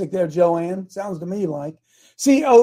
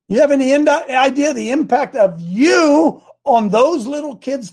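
high, urgent, stretched emphasis; impassioned encouragement; 6 semitones higher, 4 dB louder, slower than usual. Retold or imitated. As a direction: press home how much she matters, voice raised. Impassioned retold encouragement of wife.

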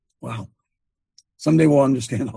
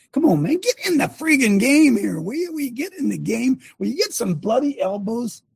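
fired up; 8 semitones higher than usual. Real, not acted. Preacher exhorting church.

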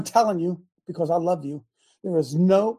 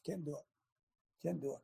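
quiet aside; 5 semitones lower, 20 dB quieter than usual. Real, not acted. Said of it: "repeated quietly for effect".

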